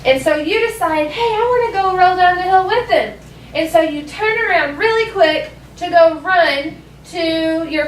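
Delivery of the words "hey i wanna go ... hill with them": higher-pitched, mildly excited playground voice; eager child. Pretend. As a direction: pipe up, keen to join the fun. Child deciding to join friends.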